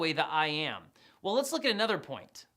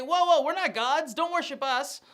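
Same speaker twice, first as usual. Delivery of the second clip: alarmed protest; 7 semitones higher, 5 dB louder than usual. Retold or imitated. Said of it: "They say".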